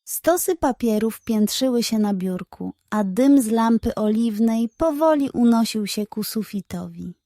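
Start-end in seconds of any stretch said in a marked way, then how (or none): none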